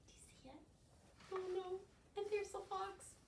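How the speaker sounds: plain reading voice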